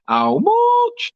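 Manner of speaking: hammed-up Yorkshire accent, squeaked very high; a mock Yorkshire shriek over the price, "How much?" held long; Yorkshire war cry. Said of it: "The Yorkshire war cry".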